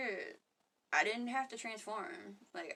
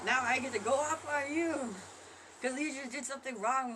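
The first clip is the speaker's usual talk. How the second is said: mock triggered ego; 6 semitones higher than usual. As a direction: voice the ego jumping at the chance to attack. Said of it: "that's like".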